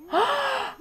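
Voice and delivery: shocked gasp. Real, not acted. Viewer stunned by a scene, high gasp. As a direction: suck in air, stunned.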